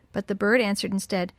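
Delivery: ordinary delivery, nothing marked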